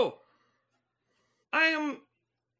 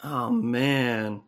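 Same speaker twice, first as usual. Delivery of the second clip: much lower dude voice; alien-changed guy; slower than usual. Acted. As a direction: drop way down into a laid-back bro. Same low character.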